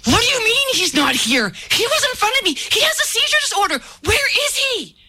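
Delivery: shrill-high, frantic retold outburst; acted hysterical freak-out, voice soaring. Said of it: "I freaked out".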